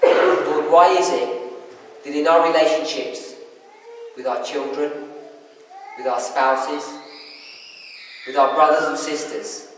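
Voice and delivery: real, earnest spoken prayer. Real, not acted. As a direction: address God softly, with long reverent pauses. Leads a closing prayer.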